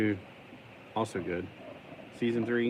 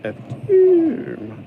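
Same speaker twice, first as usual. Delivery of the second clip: letters shrieked much higher and louder; sudden yelp; 14 dB louder than usual. Real, not acted. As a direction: blurt them out in a squeal. Startled while building.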